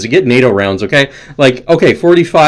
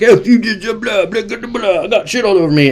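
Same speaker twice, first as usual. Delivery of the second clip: high, squawking mock tirade; Joe Biden; 8 semitones higher than usual. President waving fingers at everybody, mock yelling. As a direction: sputter high gibberish in a caricature. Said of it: "He's yelling at everybody".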